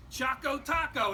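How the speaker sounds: P=A; slow recall. Retold or imitated. Ice cream man recognizes the boy.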